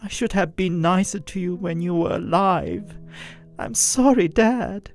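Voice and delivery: pouring out regret to a lost father; tearful plea. Acted. Daughter tearfully apologising to her dead father. Narrator in tears to dad.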